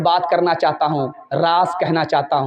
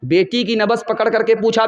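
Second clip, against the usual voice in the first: ordinary talk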